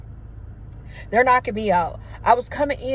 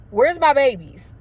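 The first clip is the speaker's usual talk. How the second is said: higher, louder anxious question; retold urgent worry; 5 semitones higher, 7 dB louder than usual. Mother waking in hospital asks after her children, acted urgent question. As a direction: re-enact calling out for your children.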